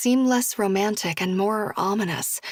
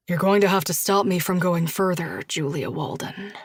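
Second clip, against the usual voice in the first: lower, intimate man's voice; low lover's voice; 3 semitones lower than usual. Acted. Josh tells Julia how he wanted her, low voiced. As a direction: confide it with longing.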